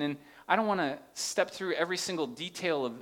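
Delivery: ordinary talk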